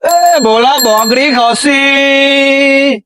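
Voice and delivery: high, slow, drawn-out street vendor cry; hawker's call. Acted. Goat buyer hawking door to door, long drawn-out sing-song call. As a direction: stretch every word like a village goat buyer calling out.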